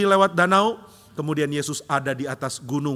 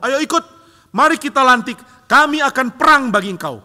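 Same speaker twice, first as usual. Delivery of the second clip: high, loud rallying cry; loud, high zealous urging; eager disciples; 9 semitones higher, 8 dB louder than usual. Retold or imitated. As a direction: whip up a crowd to crown a king.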